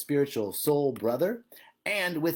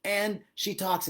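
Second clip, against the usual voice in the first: ordinary talk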